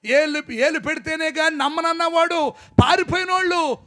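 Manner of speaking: loud, high fiery preaching; preaching shout. Real, not acted. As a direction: thunder it at the congregation.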